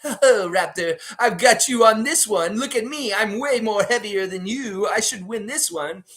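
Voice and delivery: jovial Ben Franklin voice. Retold or imitated. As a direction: tease the raptor like a jolly Franklin, up high. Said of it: "And he's just like".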